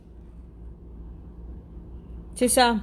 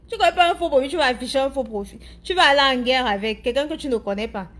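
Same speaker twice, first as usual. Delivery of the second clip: usual speaking voice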